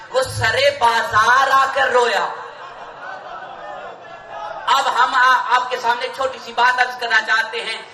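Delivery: high, ringing majlis preaching at full force; fervent sermon climax, voice lifted high; impassioned preaching. Acted. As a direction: preach it out to the gathering, pleading.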